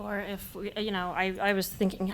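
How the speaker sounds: fighting back tears while trying to speak; choked up. Real, not acted. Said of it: "I can actually speak again now".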